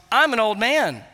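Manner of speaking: put-on emphatic "I'm", an old man pleading; stress on I'M. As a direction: hit "I'm" hard. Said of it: "He's putting emphasis on".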